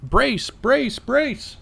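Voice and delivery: high urgent yell of brace; crew shouting brace. Acted. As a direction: shout the warning, high and sharp. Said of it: "Delphine yells out".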